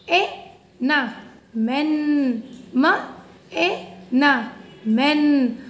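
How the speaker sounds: usual speaking voice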